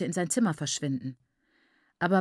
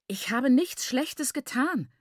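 high, slow insistence; insistent; 7 semitones higher, slower than usual. A narrator's reading. Daughter defends herself to her angry father, insisting. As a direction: hold your ground, higher and drawn out. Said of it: "beharrte Nyasha".

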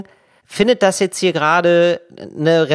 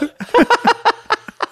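high burst of laughter. Real, not acted. Laughs at own story.

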